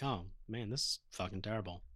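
low, soft mock misery; dazed grumble. Jumbled crew after the boat crash, dazed grumble. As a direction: grumble it quietly, as if just shaken up.

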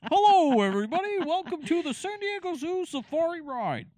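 high, loud ride announcer; tour guide announcer. Retold or imitated. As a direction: welcome riders like a safari guide. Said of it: "it's like".

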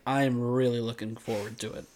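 low, soft, overly solemn quote; mock earnestness. Showing his friend what not to say, mock solemn.

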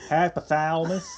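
teasing Midwestern twang through laughter; mock Minnesota accent. Co-host mimicking the podcaster's slip, mock Minnesota accent. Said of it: "it's like a Minnesota thing".

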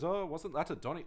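a hint of Apu's Indian accent, cut off. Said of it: "I'm not gonna do the accent".

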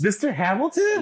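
high-pitched thrilled question; excited, disbelieving check; starstruck excitement. Real, not acted. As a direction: blurt it out like a delighted fan. Thrilled at the casting news.

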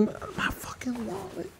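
slow, hushed whisper to yourself; mock panicked whisper. Drunk man searching for his wallet, mock panicked whisper. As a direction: whisper it slowly, as if just realizing.

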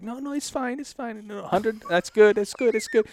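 high, hurried-sounding imitation of placating; anxious appeasing. Officers hand back the cash, imitated nervous placating. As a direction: mimic cops waving it all away. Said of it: "the panic shifted to them".

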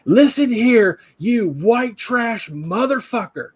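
high, loud mock tirade, slowing on the insult; imitated angry yelling. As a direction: yell it like a furious voicemail rant. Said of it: "I don't know why she's yelling".

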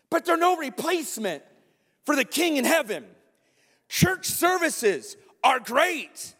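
slow, emphatic sermon build; fervent preaching. Acted. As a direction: drive the point home to the congregation. Preaching at full force.

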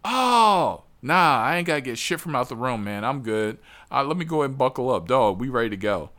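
eager friend. Retold or imitated. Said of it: "he's like".

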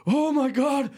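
high, slow, mock-dramatic shout; Brendan Fraser impression. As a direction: ham up an awkward emotional opener. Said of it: "Brendan Fraser gets up there and goes".

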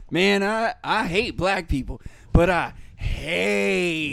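a hint of a comic's voice, dragged out at the end; mock stand-up bit. Friend mimics a comedian's bit, slow drawn-out ending. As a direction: ham up a comedian's punchline. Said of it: "and then the bits just".

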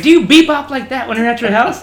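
high, loud mocking chief question; mock chief yell. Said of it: "he's like".

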